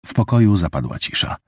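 ordinary delivery, nothing marked

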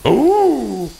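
loud high surprised oh; surprised shout. Real, not acted. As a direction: blurt it out in shock. Sudden loud exclamation.

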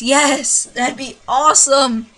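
loud, slow excited outburst; thrilled, drawing the words out. Real, not acted. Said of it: "Sorry, I was really excited over that".

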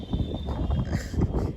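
soft breathy chuckle; quiet laugh. Real, not acted. After finishing the story, soft chuckle.

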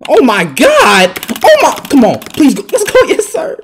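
loud, high celebratory yells; loud, excited outburst; hyped shouting. Real, not acted. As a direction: holler it, stretching "God". Celebrating a big play.

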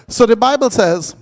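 ordinary delivery

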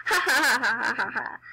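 hammed-up villain cackle; evil laugh. Girl voting for herself, mock evil cackle. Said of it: "I'm so evil".

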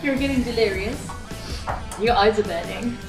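ordinary talk, nothing marked

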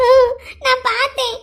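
slow, high tearful wail; crying. Acted. Girl insists she saw her sister cry, crying. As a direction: insist through sobs, drawing it out. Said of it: "அதுக்கு எதுக்கு நீ அழுதுட்டு இருக்க".

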